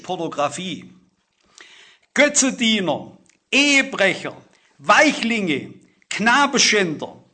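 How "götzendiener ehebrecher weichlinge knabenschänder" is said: sins called out. Real, not acted. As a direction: hammer out every sin on the list. Preacher calls out list of sins. (lautes, langsames Aufzählen jeder Sünde)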